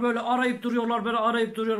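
normal talking voice

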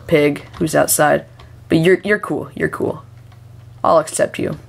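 usual speaking voice

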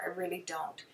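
breathy whispered confession. Real, not acted. Whispering to viewers about her painting. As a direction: drop to a whisper, half to herself.

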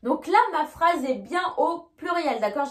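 normal talking voice

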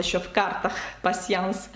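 ordinary delivery

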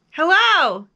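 high, loud hello; big ringing call; shouted. A narrator's reading. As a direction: holler it to someone far away. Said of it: "he shouted".

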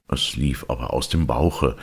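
usual speaking voice

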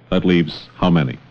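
ordinary delivery

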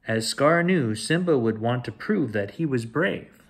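ordinary delivery

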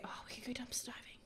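conspiratorial whisper. Real, not acted. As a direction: whisper it like a sneaky plan. Sneaky dumpster diving idea.

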